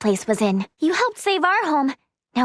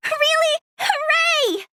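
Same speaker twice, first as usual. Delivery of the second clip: high, excited squeal of joy; delighted cheer; 10 semitones higher, slower than usual. Acted. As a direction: cheer at getting your wish. Favor is granted, cheers.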